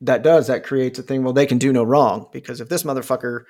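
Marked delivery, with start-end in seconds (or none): none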